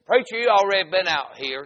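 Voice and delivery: loud, high-pitched rebuke; angry holler. Retold or imitated. Man at the gate to preacher, angry holler. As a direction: shout the preacher down.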